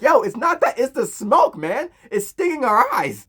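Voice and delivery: high, loud whiny protest; mock crying. Kids blaming the smoke for their tears, mock crying. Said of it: "crying up".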